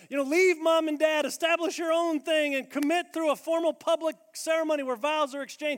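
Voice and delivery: high, loud, emphatic preaching; preaching at full force. Real, not acted. Preacher laying out the biblical standard, loud. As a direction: drive each point home.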